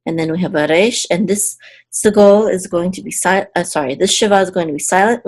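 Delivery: ordinary talk, nothing marked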